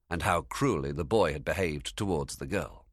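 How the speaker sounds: plain reading voice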